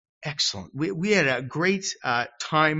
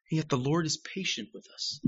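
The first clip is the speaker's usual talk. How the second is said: ordinary talk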